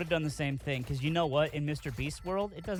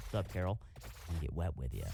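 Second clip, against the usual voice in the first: low, softer sleazy boss imitation; office boss; 9 semitones lower, 6 dB quieter, much faster than usual. Sketch boss hitting on Carol, low and sleazy. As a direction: drop your voice low for a creepy boss come-on. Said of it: "[name] as a, like, an office boss".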